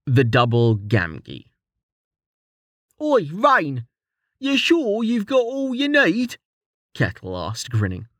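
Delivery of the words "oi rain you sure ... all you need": much higher, cheeky rough-edged mate ribbing his friend; Kettle's high teasing voice. A narrator's reading. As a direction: tease him in a high jokey voice.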